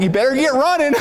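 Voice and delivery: mock urgent shout; urgent call. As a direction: hurry him along with a call. Airport worker urging him.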